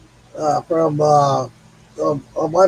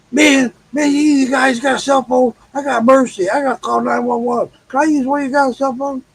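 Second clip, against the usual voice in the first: higher, panicked pleading, half shouted; frantic crackhead; 7 semitones higher than usual. Retold or imitated. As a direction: beg like a jittery stranger in trouble. Crackhead begging for a phone.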